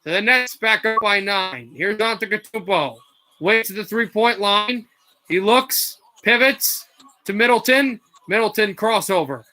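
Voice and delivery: energetic basketball call; broadcast-style play-by-play; live play-by-play. Acted. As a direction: ride the action as it happens.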